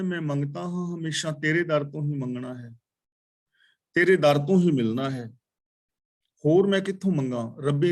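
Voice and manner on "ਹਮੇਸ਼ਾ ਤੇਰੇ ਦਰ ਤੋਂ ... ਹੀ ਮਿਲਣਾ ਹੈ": ordinary talk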